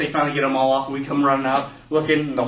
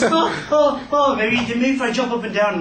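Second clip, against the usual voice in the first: high-pitched, yelping mock panic; panicked brother; 10 semitones higher, 4 dB louder than usual. Brother in the middle of the swarm, panicked. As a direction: yelp it like a swarmed kid.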